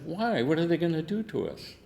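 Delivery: a high, cheeky "why?" thrown back; mock defiant challenge. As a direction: tease her with a high mocking question. Captain mocking the nurse.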